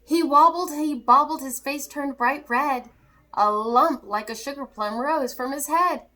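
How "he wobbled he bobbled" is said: ordinary delivery, nothing marked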